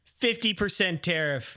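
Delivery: slow, deliberate mock Trump demand; a hint of Trump raising the tariff, slowly. Trump answers the camera with a slow, mock-stern demand. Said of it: "he's like".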